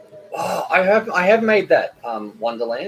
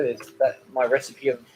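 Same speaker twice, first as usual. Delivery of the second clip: ordinary talk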